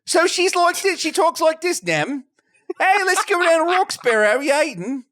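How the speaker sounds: high, hammed-up Philadelphia accent; exaggerated Philly street talk. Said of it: "she does a Philly accent?".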